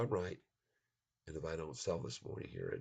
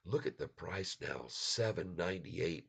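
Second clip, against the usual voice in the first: normal talking voice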